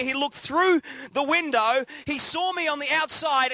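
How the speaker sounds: normal talking voice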